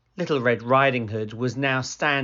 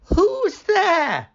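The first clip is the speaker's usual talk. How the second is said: put-on much higher, louder old-lady call; frail grandmother calling; 7 dB louder than usual. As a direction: call out to the door.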